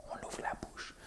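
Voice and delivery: unvoiced whisper; whispered aside. Real, not acted. Teacher coaching learners' mouths, whispering. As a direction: whisper it as a quick coaching cue.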